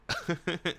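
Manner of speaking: brief staccato laugh; acted laugh. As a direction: laugh in quick little bursts.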